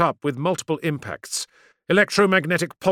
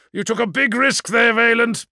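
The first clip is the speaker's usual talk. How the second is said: high, raised voice on the bridge; tense, high battle voice; urgent challenge; 8 semitones higher, 5 dB louder than usual. Acted. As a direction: call it over to the pilot. Commander to pilot mid-battle.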